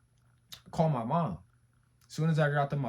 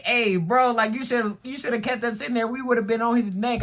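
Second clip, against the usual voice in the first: high, loud mock protest; imitating riled-up cousins; 10 semitones higher, 8 dB louder than usual. Cousins to him about being made to leave, riled up. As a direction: pitch it up and get loud like hyped younger cousins. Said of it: "my cousins, they was like".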